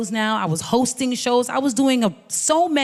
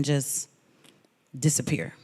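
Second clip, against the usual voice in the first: whisper; 7 semitones lower, 8 dB quieter than usual. Acted. Fear of vanishing after the move.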